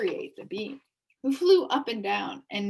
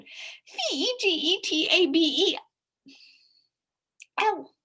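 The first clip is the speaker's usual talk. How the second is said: spelling fast; 9 semitones higher, 6 dB louder than usual. A narrator's reading. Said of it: "spelled as fast as he could".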